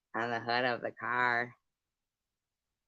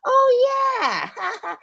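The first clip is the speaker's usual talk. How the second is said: huge high oh then yeah; mock realizing shriek; 10 dB louder than usual. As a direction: shriek it as the penny drops. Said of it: "she's like".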